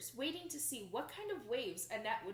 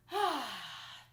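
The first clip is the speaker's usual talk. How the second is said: relieved sigh; 4 dB louder than usual. Acted. Ladies in new suits sighing with relief. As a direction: breathe out with relief. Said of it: "they sighed with relief".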